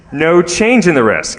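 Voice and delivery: normal talking voice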